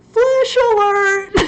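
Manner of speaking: high, loud playful warning; mock alarm. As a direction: sound the alarm like a siren, jokingly. Mock alarm about an answer.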